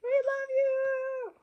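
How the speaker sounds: very high, slow, hammed-up cheer; a storyteller cheering for Santa with the kids. Shown as a demonstration. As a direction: lead the crowd in a big elf cheer. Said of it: "when you cheer with the elves".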